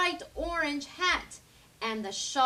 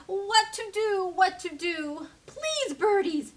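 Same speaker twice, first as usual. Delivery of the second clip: pleading; 6 semitones higher, 3 dB louder than usual. A narrator's reading. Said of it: "she pleaded".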